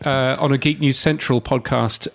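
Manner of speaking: ordinary talk, nothing marked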